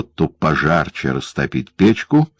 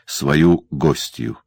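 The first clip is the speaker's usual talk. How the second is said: usual speaking voice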